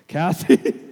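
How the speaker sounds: loud, comic rising yelp; desperate blurted call. Retold or imitated. Suitor hits the keys as she leaves, desperate blurt. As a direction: burst out with her name, half laughing.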